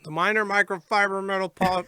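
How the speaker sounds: talking through a mouthful of food; words muffled round a bite; mouth full. Real, not acted. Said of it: "Caught you with your mouth full".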